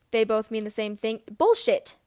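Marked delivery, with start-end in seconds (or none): 1.4-2.0 loud, high outburst; raised voice. Acted. James yells at Regulus for the first time. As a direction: shout it, shocked and furious. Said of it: "He's never raised his voice".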